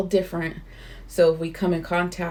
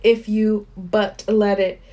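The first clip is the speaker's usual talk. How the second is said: slow, louder solemn reading voice; earnest book reading; 4 semitones higher, 5 dB louder, slower than usual. Acted. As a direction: read it like scripture. Reads book passage aloud.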